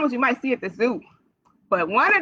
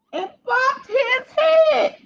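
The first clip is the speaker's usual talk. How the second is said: mock alarm; much slower than usual. Librarian telling kids a bunny fell, mock dismayed wail. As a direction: play the bump as a big pretend disaster.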